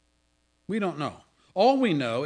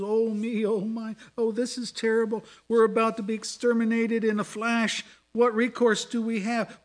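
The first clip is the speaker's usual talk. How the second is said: higher, mock-fretful moaning of woes; mock hand-wringing; 7 semitones higher than usual. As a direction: ham up a worrier's lament, lightly. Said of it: "repeating ominous woes".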